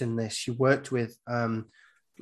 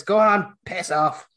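loud, higher "piss off"; telling them to leave; 7 semitones higher, 6 dB louder, slower than usual. Retold or imitated. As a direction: shout them off the driveway. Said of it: "he says".

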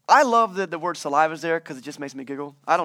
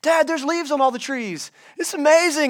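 put-on excited kid; 8 semitones higher than usual. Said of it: "riding home with my dad and be like".

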